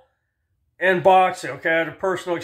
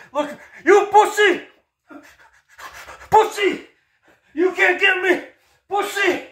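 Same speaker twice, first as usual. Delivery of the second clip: much higher, laughing schoolyard taunt; mocking taunt; 12 semitones higher than usual. Pro boxers running from Jake Paul, high laughing taunt. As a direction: jeer like a kid who's running away.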